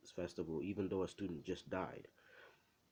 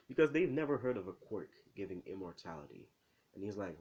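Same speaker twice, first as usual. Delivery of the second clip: usual speaking voice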